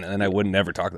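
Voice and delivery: usual speaking voice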